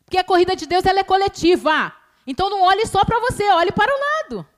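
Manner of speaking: sermon climax, pushed up and loud; fervent preaching. Acted. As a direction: preach it out to the congregation. Preaching at full force.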